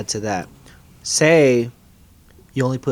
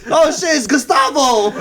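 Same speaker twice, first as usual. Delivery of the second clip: excited exclamation; 12 semitones higher, 3 dB louder than usual. Pretend. Mock alarm at joke arrival.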